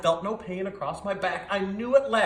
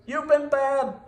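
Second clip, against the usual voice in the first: higher, louder pleading call; urgent cry; 6 semitones higher, 5 dB louder than usual. A narrator's reading. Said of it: "But Sam cried".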